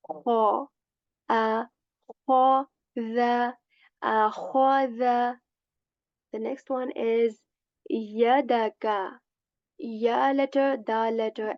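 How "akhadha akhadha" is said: "akhadha" very slow, a rough kh from the upper throat, mouth full; full-mouth kha. Shown as a demonstration. Teacher repeats akhadha slowly with a full-mouth kha. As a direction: stretch "akhadha" with a scratchy kh. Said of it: "this will be a full mouth letter".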